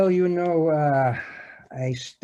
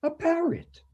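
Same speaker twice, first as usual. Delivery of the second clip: incredulous; 12 semitones higher, 3 dB quieter than usual. Acted. Detective incredulous at parrot claim.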